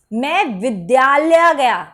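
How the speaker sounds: "विद्यालय" punched loud and drawn out; short "मैं", then a slow heavy "विद्यालय"; stress on विद्यालय. Shown as a demonstration. Teacher modelling for students, weight on "विद्यालय". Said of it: "विद्यालय पे बल दो".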